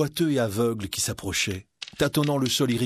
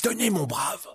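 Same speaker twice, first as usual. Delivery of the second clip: little monk; 8 semitones higher than usual. Acted. Monk gives money to old man.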